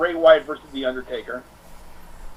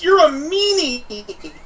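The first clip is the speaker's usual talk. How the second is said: put-on high, slow childlike taunt; Bayley calling out a meanie; 11 semitones higher, much slower than usual. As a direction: stretch it out like a hurt little girl. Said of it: "She goes".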